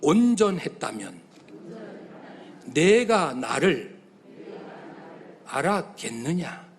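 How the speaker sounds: slow, halting phrases with long pauses; slow call for the congregation to repeat. Shown as a demonstration. Preacher leading the congregation in repeating God's words, slow and halting. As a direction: dictate each phrase slowly so the church can echo it. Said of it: "그 다음에 따라합시다".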